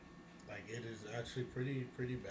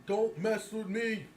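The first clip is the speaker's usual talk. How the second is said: slow, high, louder mock tough-guy warning; mock tough loner; 6 semitones higher, 5 dB louder, slower than usual. Mocking a loner on social media, slow hammed-up tough warning. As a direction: ham up a loner pushing everyone away. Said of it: "That's what it sound like".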